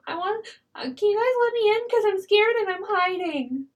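put-on high, pleading little-kid voice; lost child act. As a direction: play a frightened lost child begging to come in. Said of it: "pretend to be a lost child".

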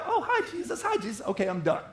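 high, laughing fake-sweet hello; a breezy, laughing hello and brush-off; mock cheerful greeting. Pulling Jesus off the shelf.

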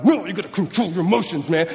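exasperated scolding in a strained voice; hammed-up frustrated dad; mock angry parent. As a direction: act out losing your temper at a child. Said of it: "I see myself getting angry".

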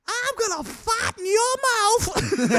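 loud, very high, drawn-out Brooklyn woman impression; Brooklyn accent. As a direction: screech it slowly in hammed-up Brooklynese. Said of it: "she's got this Brooklyn accent".

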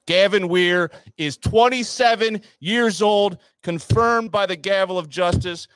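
high, loud hype reveal; excited loud announcement. Real, not acted. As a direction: belt it like a big reveal. Loud reveal then catchphrase.

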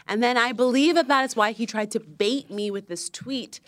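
ordinary talk

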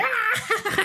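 very high shrieking squeal; Gremlins scream. Retold or imitated. Gremlins watching a movie, very high excited shriek. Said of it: "them just being like".

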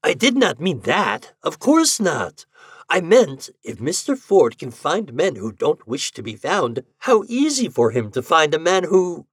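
high-pitched, emphatic explaining; crying out. A narrator's reading. Doctor explaining himself to the offended widow, crying out. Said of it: "the doctor cried".

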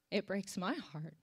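low, soft and halting, close to crying; holding back tears. Real, not acted. Pastor confessing disunity breaks their heart, holding back tears. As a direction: speak low, trying not to cry. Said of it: "I'm gonna try not to cry".

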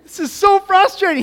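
loud, high-pitched hammed-up exasperation; mock frustrated outburst. Preacher acting out how God does not react to your mess, loud mock frustration. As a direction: blow up in exaggerated disbelief at someone's mess. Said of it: "he's not like".

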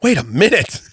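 ordinary talk

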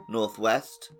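ordinary talk, nothing marked

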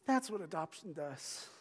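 usual speaking voice

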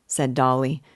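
usual speaking voice

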